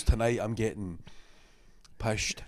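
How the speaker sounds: put-on soft Scottish accent, long pause before the last word; Scottish guy. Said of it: "the Scottish guy with a bottle of Buckfast".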